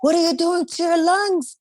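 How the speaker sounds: put-on high, startled dad scolding the kids; a high-pitched shocked father; imitating dad's alarm. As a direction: do Dad gasping at their smoking. Dad scolding kids about smoking.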